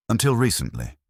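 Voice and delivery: a touch of Poirot coaxing her on "Until recently?"; gentle prompt. A narrator's reading. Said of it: "Poirot prompted gently".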